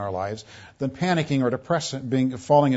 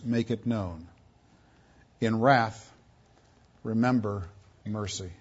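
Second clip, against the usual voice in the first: solemn reading that fades to a hush on mercy; fading scripture reading; 3 semitones lower, 6 dB quieter than usual. Acted. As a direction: let the last words die away. Scripture reading ends softly.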